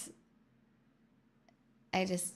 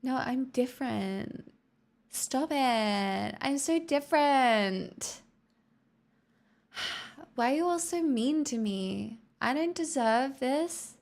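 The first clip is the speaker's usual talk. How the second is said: higher, drawn-out playful whine; mock whining; 4 semitones higher, 3 dB louder, slower than usual. Streamer pretending chat is mean to her, mock whining. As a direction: protest like a hammed-up victim. Mock protest at chat's teasing.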